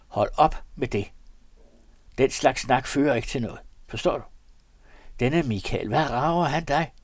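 loud, forceful rebuke; stern scolding. A narrator's reading. Valse Peter rebukes his companion, loud and stern. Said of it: "sagde Valse Peter strengt".

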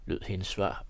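plain reading voice

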